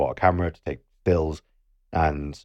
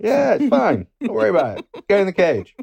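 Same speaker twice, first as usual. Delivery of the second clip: high, breezy mock guide voice, lightly done; mock carefree guide; 11 semitones higher, 4 dB louder than usual. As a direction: brush off their worries like a careless instructor. Mocking the dive guide's reassurance.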